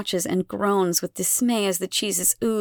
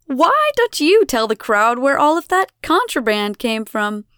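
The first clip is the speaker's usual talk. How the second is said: raised, louder public challenge; taunting demand; 5 semitones higher, 6 dB louder than usual. A narrator's reading. Elena challenges the children before the crowd, taunting demand. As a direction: make a show of it for the crowd.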